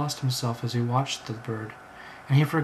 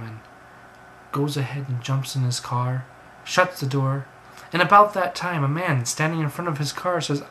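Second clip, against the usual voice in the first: ordinary delivery, nothing marked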